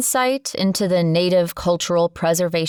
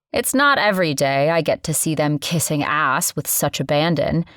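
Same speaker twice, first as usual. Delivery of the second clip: ordinary delivery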